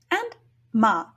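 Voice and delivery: "ma" short and light; Mandarin neutral tone. Shown as a demonstration. Teacher demonstrating neutral tone "ma", short and light. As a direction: say "ma" quick and unstressed. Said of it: "they should sound something kind of like".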